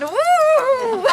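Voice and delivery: high, loud imagined cheer from the class; mock children's cheer; kids cheering. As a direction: whoop like a room of thrilled kids. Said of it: "all the kids would have gone".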